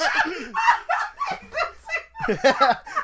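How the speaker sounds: very high helpless laughter; shrieking laugh. Real, not acted. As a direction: let the laugh burst out high. Cracking up at the quote.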